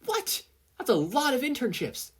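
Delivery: squeaking high with disbelief; shocked. Acted. Ochako seeing her request count, shocked and high. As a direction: jump way up in pitch, stunned.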